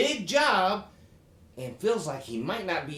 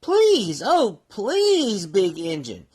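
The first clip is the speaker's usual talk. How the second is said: crying out please; 4 dB louder, slower than usual. Acted. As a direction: beg with all your heart. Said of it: "cried all the dolls and toys".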